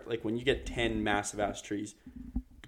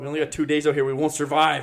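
mock panic; 6 semitones higher, 9 dB louder than usual. Mock dramatic survival panic.

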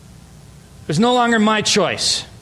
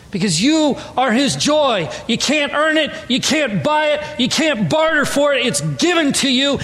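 full-force preaching; 6 semitones higher than usual. Acted. Pastor exhorting the congregation, preaching at full force. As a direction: lift the room and drive it home.

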